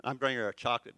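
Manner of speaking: normal talking voice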